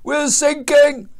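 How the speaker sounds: high, loud, drawn-out alarm; acted desperate wail; panicked sailor's cry. A narrator's reading. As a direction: shout it over a storm. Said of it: "cried a sailor".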